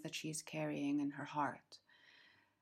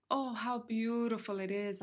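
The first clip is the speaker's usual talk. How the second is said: cried out; 4 semitones higher than usual. Acted. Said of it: "I cried out".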